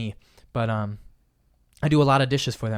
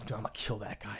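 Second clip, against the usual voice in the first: much softer, low grumble; muttered grudge; 4 semitones lower, 12 dB quieter than usual. Retold or imitated. Old self holding a grudge, softly grumbling. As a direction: grumble it under your breath. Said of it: "I'm like".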